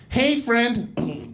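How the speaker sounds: sing-out chummy mock greeting; mock friendly kid. Mocks a kid's friendly greeting.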